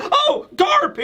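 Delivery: very high mock-excited cry; overjoyed. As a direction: imitate an amazed fan spotting his hero. Acts Helmeppo thrilled to see Garp.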